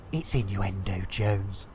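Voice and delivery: very low, grave pronouncement; deep catchphrase voice. Acted. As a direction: drop way down and announce it.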